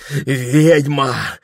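high, loud cry; loud accusation. A narrator's reading. Dreaming Прохор to the witch, loud accusation. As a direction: hurl it as an accusation.

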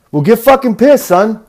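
loud, high mock English lad; rowdy English bloke impression; English bro accent. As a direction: egg on a mate like an English bro. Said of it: "broy dudes from, like, England".

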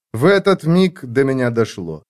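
ordinary delivery

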